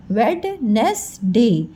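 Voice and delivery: वेड, नेस, डे in three separate syllables, the D sounded; spelling pronunciation. Shown as a demonstration. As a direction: break it up as written: वेड, नेस, डे. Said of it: "लिखते समय बेटा हम ऐसे लिखते हैं".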